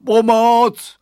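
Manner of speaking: high, very loud yell; cry for help. A narrator's reading. Bully buried under crates, yelling for help. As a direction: yell out for rescue.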